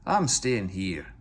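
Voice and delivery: slow, stubborn refusal; rude, stubborn. A narrator's reading. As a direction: dig in and refuse. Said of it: "said Duncan rudely".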